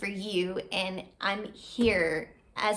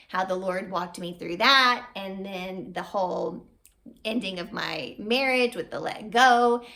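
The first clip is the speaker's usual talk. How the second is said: ordinary delivery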